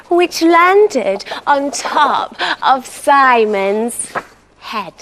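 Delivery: usual speaking voice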